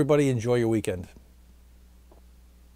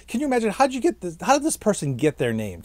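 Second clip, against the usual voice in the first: incredulous; 8 semitones higher than usual. Real, not acted. Marveling at odd name.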